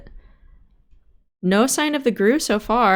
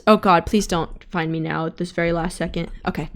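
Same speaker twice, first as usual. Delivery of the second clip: ordinary talk, nothing marked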